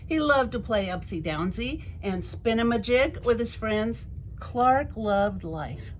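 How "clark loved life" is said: ordinary delivery, nothing marked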